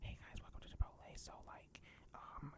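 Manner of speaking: very soft whispered imitation; hushed, voiceless impression of an interviewer; whispering interviewer. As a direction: mimic her in a barely audible whisper. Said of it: "she's talking like this".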